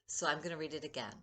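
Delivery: ordinary talk, nothing marked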